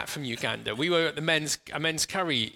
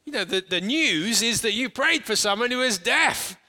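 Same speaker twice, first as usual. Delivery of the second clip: higher and louder, punching prayed; emphatic excitement; 7 semitones higher, 4 dB louder, slower than usual. Real, not acted. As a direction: sell the real headline. Stressing the healing news.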